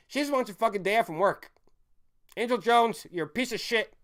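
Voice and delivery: ranting angrily at the professor; angry rant. Real, not acted. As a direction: tear into her.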